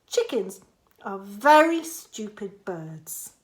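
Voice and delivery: usual speaking voice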